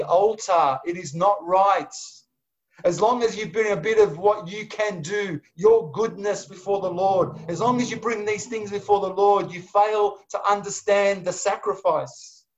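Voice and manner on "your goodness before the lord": ordinary talk, nothing marked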